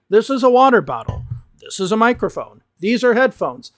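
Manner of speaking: ordinary talk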